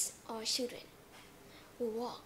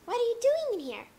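house owner; 2 semitones higher, 10 dB louder than usual. Acted. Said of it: "when the owners of the house comes".

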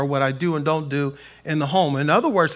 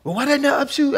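higher-pitched mock questioning; imitated upset wife; 9 semitones higher, faster than usual. Preacher acts out wife challenging her calm husband, high questioning voice. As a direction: voice a wife's incredulous question up high.

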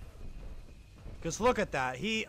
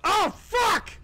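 a sudden very high yelled curse; startled shout; 12 semitones higher, 6 dB louder than usual. Real, not acted. Player jumping at a scare, screaming curse. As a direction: scream it out in real shock.

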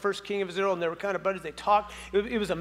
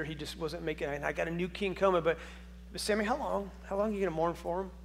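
quiet, gentle voice of God asking; soft tender tone; 2 semitones lower, 5 dB quieter than usual. Retold or imitated. God gently asks grieving Samuel how long he'll mourn, soft and tender. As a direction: ask gently, like a caring friend. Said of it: "I think it's a soft tone".

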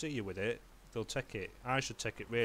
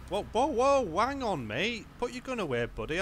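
calling out; 6 semitones higher, 4 dB louder than usual. Acted. Cop calming armed man.

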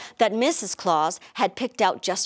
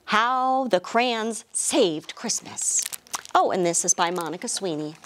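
ordinary delivery, nothing marked

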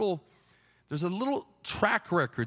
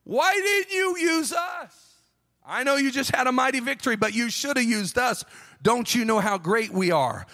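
indignant outcry; 3 semitones higher than usual. Acted. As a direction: shout like an offended tribe demanding answers. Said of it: "opens the door and says".

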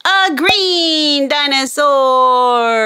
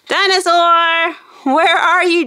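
ordinary delivery

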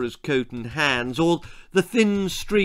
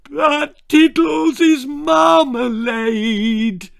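high, loud, slow wailing cry; bawling; 12 semitones higher, 7 dB louder, much slower than usual. Acted. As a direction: sob it out like a heartbroken child. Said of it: "she bawled".

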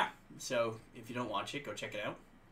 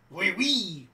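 French accent; 5 semitones higher, 8 dB louder than usual. Shown as a demonstration. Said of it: "French accent".

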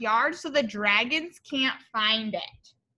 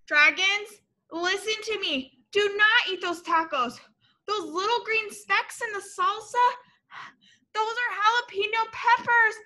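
much higher urgent pleading with the dragons; urgent warning; 7 semitones higher than usual. A narrator's reading. Reader urgently warning the dragons not to eat tacos. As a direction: plead with them to stop, voice high.

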